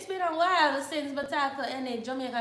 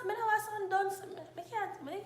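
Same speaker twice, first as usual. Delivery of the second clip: ordinary delivery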